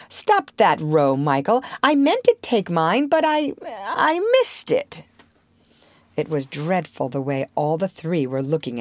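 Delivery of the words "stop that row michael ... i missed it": flustered. A narrator's reading. Father flustered excuse.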